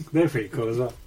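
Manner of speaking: ordinary talk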